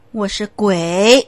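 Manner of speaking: slow, loud, strange voice; ghost confessing. Acted. The spirit finally admits to the pastor it is a ghost, slow and loud. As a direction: drop the mask and reveal the demon.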